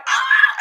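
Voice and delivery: a quick startled yelp; retold scream. Spotting bugs running at three a.m., a short startled scream. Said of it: "And I scream".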